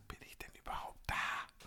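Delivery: soft unvoiced whisper. Real, not acted. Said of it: "whisper heard".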